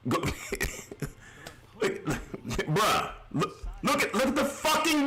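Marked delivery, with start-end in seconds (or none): 0.2-1.1 brief breathy unvoiced laugh; a quick disbelieving laugh; short laugh. Real, not acted. Laughs at the game's graphics.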